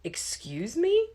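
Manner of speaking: high, slow, offended excuse me; a professor bristling at a muttered remark; indignant surprise. A narrator's reading. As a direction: challenge what he just said. Professor reacting sharply.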